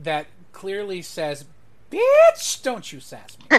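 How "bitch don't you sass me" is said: very high, loud mock-scolding burst; sassy warning. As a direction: squeal the first word, then scold with sass. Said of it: "she crooks an eyebrow at him".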